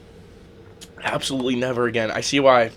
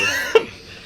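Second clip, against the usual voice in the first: nervous giggle. Real, not acted. Nervous before eating the pepper.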